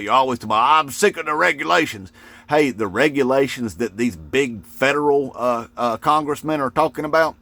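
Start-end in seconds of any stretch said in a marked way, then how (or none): 0.8-2.1 higher-pitched imitation of a griper; mock complaining. Mimicking voters bitching about regulations, mock complaint. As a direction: whine it in parody of a fed-up voter. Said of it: "regulations that you bitch about".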